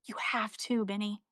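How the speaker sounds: soft, low-key retold advice; imitating a hushed, serious mentor; quiet earnest urging. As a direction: drop the volume to press it home gently.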